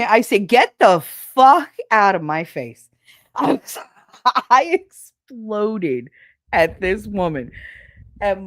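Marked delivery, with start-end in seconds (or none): 0.5-2.9 retold explosion. Exploding at coworker.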